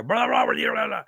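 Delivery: high, quick creature babble; imitating fast Sasquatch chatter; rapid-fire gibberish. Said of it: "goes off on this rapid fire".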